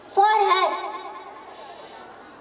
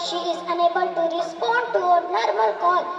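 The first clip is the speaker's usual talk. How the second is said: usual speaking voice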